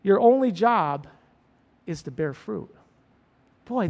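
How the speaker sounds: usual speaking voice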